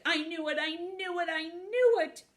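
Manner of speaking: high, excited repeated cry; delighted triumph. Acted. Wolf delighted after the hug.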